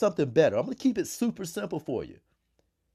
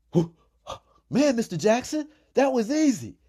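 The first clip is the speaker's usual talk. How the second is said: higher, amazed retelling of an easy win; excited, relieved mentee; 5 semitones higher than usual. Retold or imitated. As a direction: play Chuck buzzing after his first success. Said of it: "Chuck is excited".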